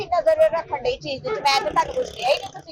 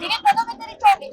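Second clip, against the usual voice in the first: indignant shriek. Acted. Woman protests indignantly.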